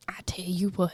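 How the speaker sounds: breathy unvoiced whisper. Real, not acted. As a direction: whisper it as an aside. Whispered aside.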